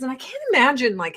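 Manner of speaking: ordinary talk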